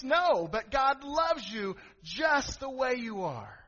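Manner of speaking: fervent preacher; preaching. Acted. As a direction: lift the congregation slowly. Preaching at full force.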